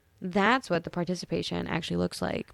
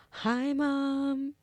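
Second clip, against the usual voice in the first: sweet greeting; 7 semitones higher than usual. Real, not acted. Greeting her mom listening.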